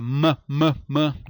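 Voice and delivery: "m, m, m" como mâ, mâ, mâ isolados; som de consoante. Shown as a demonstration. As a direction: repita "m, m, m" em sílabas curtas e separadas. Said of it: "tem que ser consoante". ("m, m, m" dito mâ, em golpes curtos)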